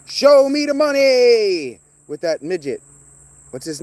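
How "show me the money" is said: high, slow, loud yelled movie line; Cuba Gooding Junior shout. Retold or imitated. Imitating Cuba Gooding Junior's movie line, yelled slow and high. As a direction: bellow it like the famous movie scene. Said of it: "Cuba Gooding Junior was going".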